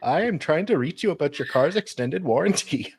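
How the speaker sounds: put-on high, lilting Indian-sounding accent; badly done accent sliding into Indian; sing-song call-centre pitch in an Indian-like accent. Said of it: "that sounded much more Indian".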